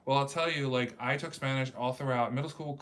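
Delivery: usual speaking voice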